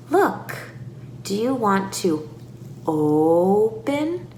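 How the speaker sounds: pause, then a long rising "open"; slow child-directed question with stretched "open"; stretched-out key word. Shown as a demonstration. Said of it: "stretch out words".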